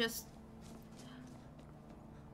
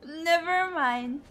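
louder, slow, higher "never mind"; loud drawn-out; 6 semitones higher, 8 dB louder, slower than usual. Real, not acted. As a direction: stretch it out loudly, sing-song. Repeats it louder.

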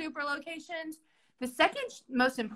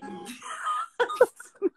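high helpless laugh. Real, not acted. Host cracking up reading the job script, high helpless laugh. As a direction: dissolves into laughter. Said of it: "I'm sorry, I'm laughing".

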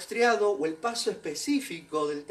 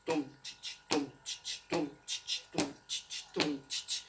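soft rhythmic drum sounds tum chi chi; imitated bass drum; 6 semitones lower, 10 dB quieter than usual. Shown as a demonstration. Dance teacher softly voicing the bombo rhythm. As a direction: mouth the bombo beat softly. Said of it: "va acompañado del bombo".

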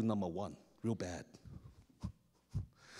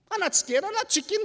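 loud high protest, covering fear; false bravado; 8 dB louder than usual. Retold or imitated. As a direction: insist you're brave, too loudly. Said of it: "You chicken".

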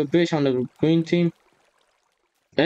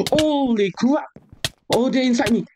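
screaming while falling; 9 semitones higher than usual. Real, not acted. Falling, panicked in game.

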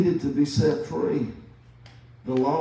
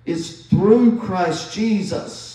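through slowed and leaned on; stress on through; 6 semitones higher, 5 dB louder, much slower than usual. Shown as a demonstration. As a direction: drag out through, slow and weighty. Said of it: "I'm emphasizing these words".

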